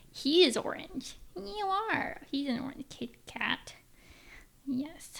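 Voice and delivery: high cooing pet voice. Real, not acted. Talking to her orange cat in cute pet talk. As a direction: coo at the kitty lovingly.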